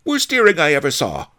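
fast, loud, high-pitched sneer. A narrator's reading. Fat Fu running down the earring, high loud sneer. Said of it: "sneered Fat Fu".

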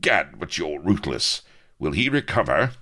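wincing dismay. A narrator's reading. Avery hearing of Lardo's fate, dismayed. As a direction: wince at the news as you ask. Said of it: "Avery went pale, wincing".

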